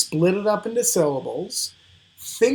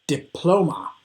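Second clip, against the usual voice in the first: diploma as di-PLO-ma, falling at the end; correct middle stress. Shown as a demonstration. Teacher confirms the right answer, diploma stressed on PLO. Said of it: "it's that middle one".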